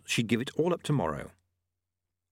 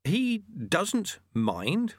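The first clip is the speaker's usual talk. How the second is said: careful probing; 10 semitones higher, much slower than usual. A narrator's reading. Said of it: "in the tones of one carefully exploring".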